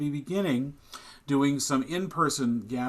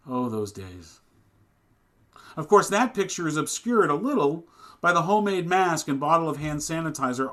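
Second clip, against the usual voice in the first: rising, louder sermon cadence; preaching; 4 semitones higher, 6 dB louder than usual. Acted. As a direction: preach it to the congregation. Sermon delivered from the pulpit.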